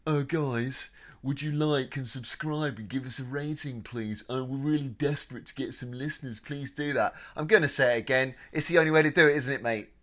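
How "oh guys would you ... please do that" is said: put-on begging for listeners; mock pleading. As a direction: plead mockingly like a needy podcaster. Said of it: "the one sentence that I loathe myself saying".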